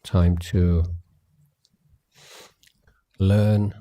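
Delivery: usual speaking voice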